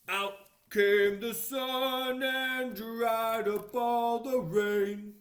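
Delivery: put-on slow, loud read-aloud for children; storybook reading. A narrator's reading. Reading the book page aloud to children, slow and loud. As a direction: read it out big and slow for little listeners.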